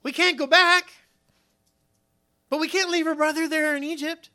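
high-pitched fretting, acted; acted alarm, high and agitated; afraid. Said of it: "they were afraid, saying to one another".

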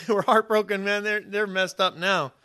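ordinary delivery

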